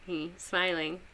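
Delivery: normal talking voice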